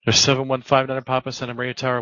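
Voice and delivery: ordinary talk, nothing marked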